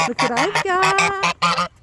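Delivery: slow high coaxing. Real, not acted. Tempting goose with lettuce.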